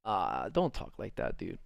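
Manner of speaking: low, soft, uncomfortable mock plea; cringing reaction. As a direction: act out wincing at her words. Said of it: "It was kinda like".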